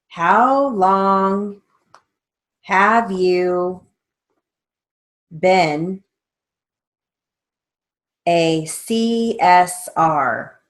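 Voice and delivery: slow, deliberate dictation read with pauses. Shown as a demonstration. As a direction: dictate each word slowly and clearly for the class. Dictation to court reporting students.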